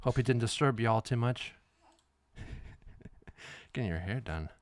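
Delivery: ordinary talk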